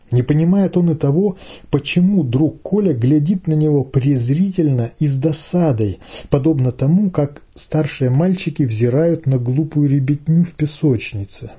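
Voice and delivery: ordinary delivery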